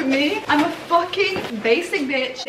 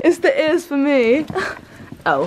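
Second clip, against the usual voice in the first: normal talking voice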